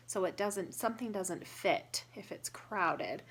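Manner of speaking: ordinary delivery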